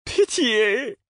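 very high, rising desperate plea; crying out. A narrator's reading. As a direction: cry out for mercy, high and thin. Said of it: "cria-t-il".

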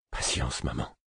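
faint voiceless whisper; dying whisper. A narrator's reading. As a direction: breathe the words almost unheard. Dying man whispers names.

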